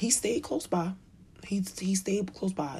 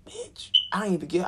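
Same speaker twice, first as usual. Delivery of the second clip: ordinary talk, nothing marked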